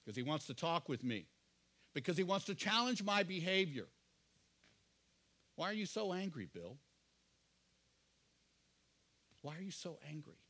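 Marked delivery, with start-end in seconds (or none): 5.5-10.4 soft, tender asking from God; gentle fatherly question. Retold or imitated. God to Bill, holding his face: soft fatherly question. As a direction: lean in close and ask it gently, softer. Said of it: "grabs by the cheeks and says".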